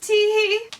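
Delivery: high, loud spelled-out titter; mock giggle. Storybook character finishing a joke, high fake titter.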